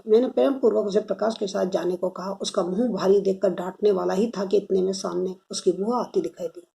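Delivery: plain reading voice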